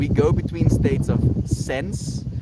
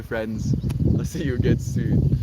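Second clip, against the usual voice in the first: ordinary talk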